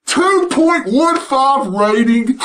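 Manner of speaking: slow, drawn-out repeat with a laugh; slow disbelief. Real, not acted. Vlogger repeats the ratings number, slow and amused. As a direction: savour each word in disbelief.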